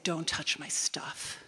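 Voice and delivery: slow, tense whisper; whispered warning. Retold or imitated. Quiet warning to hostile housemate.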